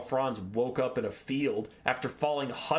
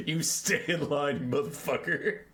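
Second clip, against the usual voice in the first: mock bully. Beaky bullying the Pegasus.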